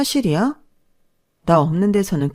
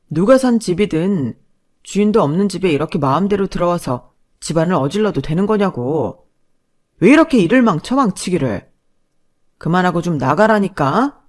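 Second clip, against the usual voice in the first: a touch of an exasperated husband; irritated husband; 6 dB louder than usual. Acted. Husband scolds his mother and sister, irritated. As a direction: snap at them to get out.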